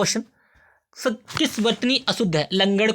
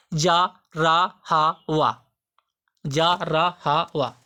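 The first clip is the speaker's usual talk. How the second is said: slow listing; 3 semitones lower, much slower than usual. Shown as a demonstration. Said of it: "listing letters".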